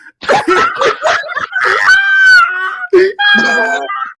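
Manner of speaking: loud, very high screaming laugh; helpless high-pitched cackling; shrieking laughter. Real, not acted. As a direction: crack up into piercing shrieks. Cracking up at the Biden quote.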